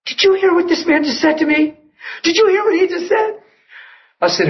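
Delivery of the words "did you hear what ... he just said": high-pitched, incredulous retold question; astonished mock quote. Woman grabs her friend's arm, astonished. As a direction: play her stunned disbelief, voice jumping up.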